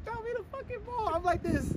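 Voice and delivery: high put-on girl voice; squeaky falsetto girl voice; man doing a woman's voice. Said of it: "we gotta practice our girl voice".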